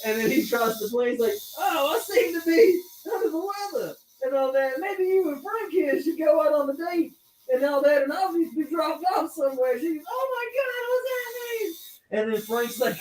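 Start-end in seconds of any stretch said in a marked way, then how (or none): 1.6-9.8 high, hammy Texan playing unwell; put-on Texan feigning an illness; Charlie's Texan tycoon. Tycoon handing his date over to Frank, hammy Texan accent. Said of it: "dresses like a Texan oil tycoon". 10.1-11.7 very high imitated woman's voice; alarmed woman. Retelling the baffled date's reply, high mock alarm.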